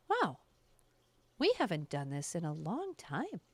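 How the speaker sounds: lightly voiced dry inner voice, amused; a calm, detached voice behind her head; matter of fact spirit voice. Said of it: "very matter of fact".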